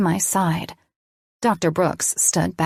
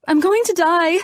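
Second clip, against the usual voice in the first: far higher, louder hysterical cry; rising panic; 12 semitones higher, 4 dB louder than usual. A narrator's reading. Patient spiraling into panic, much higher and louder. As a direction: let the panic break loose. Panic attack in hospital bed.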